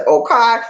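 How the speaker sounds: OKAY high and loud, ending "kye"; attention-getting okay. Shown as a demonstration. Speaker showing how he gets attention, high punched okay. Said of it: "get people's attention. When I say".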